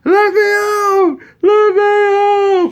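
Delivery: slow, high-pitched screamed plea; drawn-out panicked yell; mock screaming. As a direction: imitate trapped people screaming to escape. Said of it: "People in there screaming".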